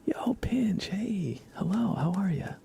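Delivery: ordinary talk, nothing marked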